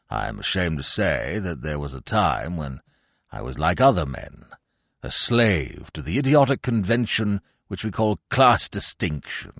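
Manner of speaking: put-on low, sententious old man's voice; pompous old gentleman. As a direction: declaim it grandly, deep and deliberate. Old Little's solemn confession.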